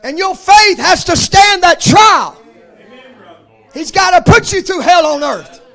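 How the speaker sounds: loud, ringing pulpit cadence, each phrase hammered home; full-force preaching. Acted. Preacher to his congregation, full-force shouted preaching. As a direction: preach it like a revival climax. Preacher building on faith trials.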